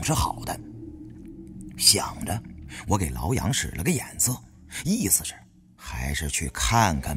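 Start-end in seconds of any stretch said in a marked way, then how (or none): none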